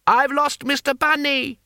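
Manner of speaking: very high little voice calling out; high-pitched wail of loss; plaintive cry. A narrator's reading. Small voice cries out in crowd.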